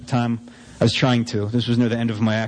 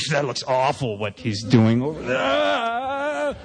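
lightly mock the bass player with a lofty sneer; mock disdain; much slower than usual.

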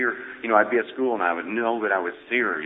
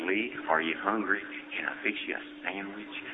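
soft grandmother voice; 3 semitones lower, 11 dB quieter than usual. Retold or imitated. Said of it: "My grandmother would say".

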